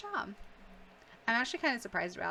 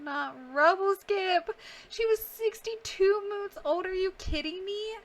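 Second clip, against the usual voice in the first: outraged cry; 9 semitones higher, 6 dB louder than usual. Real, not acted. Favourite cat just murdered.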